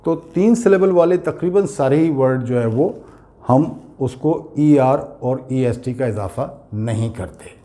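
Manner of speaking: usual speaking voice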